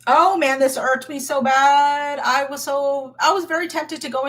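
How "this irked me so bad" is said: ordinary delivery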